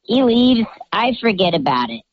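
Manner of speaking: usual speaking voice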